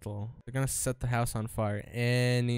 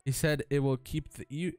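ordinary talk

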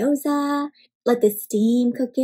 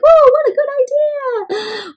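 dumpling cousins' voice; 13 semitones higher, 4 dB louder, slower than usual. Acted. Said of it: "They're like".

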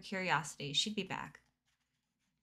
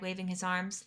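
usual speaking voice

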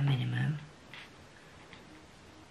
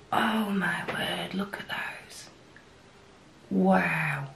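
awed whisper; 2 semitones higher, 4 dB quieter, slower than usual. Real, not acted. Admiring the Chick Lit swatch.

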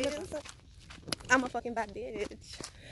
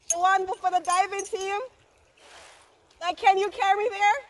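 loud, raised voice, calling across the dock; calling out loudly; 9 semitones higher, 7 dB louder than usual. Real, not acted. Stranded woman calling to boatmen for a ride, loud hail. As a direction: call out to get a boat's attention, high and loud.

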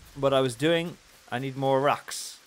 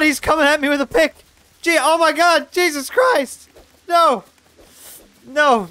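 panicked shrieking; 10 semitones higher, 10 dB louder than usual. Real, not acted. Skeleton attacks with pickaxe.

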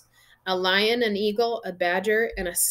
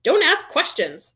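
high, loud irritated order; snapping; 8 semitones higher, 5 dB louder than usual. A narrator's reading. Uncle cuts off the boy, snapping. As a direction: snap back to stop the questions. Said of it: "snapped his uncle".

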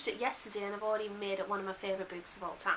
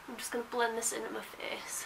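whispering. Real, not acted. Quiet aside while applying makeup.

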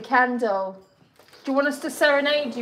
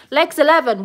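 higher, louder bingo call; bingo caller; 6 semitones higher, 8 dB louder than usual. Shown as a demonstration. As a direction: call the number like a bingo host. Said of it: "We need to cancel Legs eleven".

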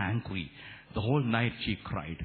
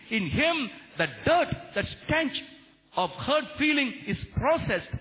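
high, ringing sermon delivery; fervent preacher; impassioned preaching; 13 semitones higher, 4 dB louder than usual. Real, not acted. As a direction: proclaim it to the congregation, pitched high and emphatic. Preaching at full force.

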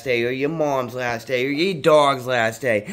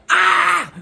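pained scream; 5 dB louder than usual. Retold or imitated. Retold catheter pain scream.

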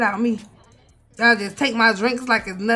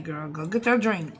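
normal talking voice

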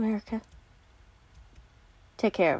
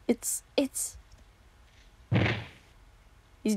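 hushed whisper; 9 dB quieter, slower than usual. Acted. Horrified at finding body.

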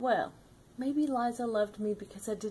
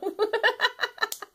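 high, far-off cackle; squealing laugh; high giggle; 4 dB louder than usual. Real, not acted. Laughing at her own story.

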